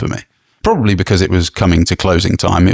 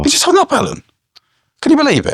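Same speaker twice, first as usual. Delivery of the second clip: outraged disbelief; faster than usual. Real, not acted. Telling a friend about the hang-up, high outraged disbelief. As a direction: pitch shoots way up in indignant disbelief.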